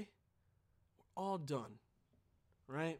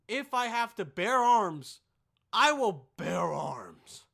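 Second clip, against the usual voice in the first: slow, louder, every word weighted; solemn vow; 9 dB louder, slower than usual. Pretend. Vlogger vowing to defend his family, slow and heavy. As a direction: swear it like an oath.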